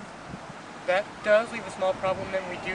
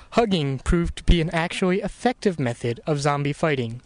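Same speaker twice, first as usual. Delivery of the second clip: steady, formal documentary voice; documentary narrator; 7 dB louder than usual. Acted. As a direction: narrate it like a history special.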